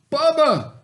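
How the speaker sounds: high, loud call of the name; shouting. A narrator's reading. Stepbrother shouting for Bubba to fetch things. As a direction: yell for him across the ranch. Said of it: "shouted his wicked stepdaddy".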